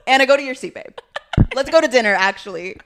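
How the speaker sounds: loud, laughing, hammed-up diva. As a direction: play a parched diva, laughing loudly. Playing diva on the carpet.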